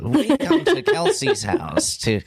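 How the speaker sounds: high, slow, hammed-up announcer voice; mock TV announcer. Joking about the young interviewee, in mock announcer voice. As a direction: ham it up like a hidden-camera show host.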